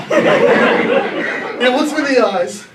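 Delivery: laughing. Real, not acted. Laughs retelling the pat down.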